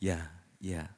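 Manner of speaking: soft, low polite agreement; polite Javanese yes. Retold or imitated. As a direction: nod along softly. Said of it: "Kalau orang Jawa bilang". (iya-iya yang halus dan pelan)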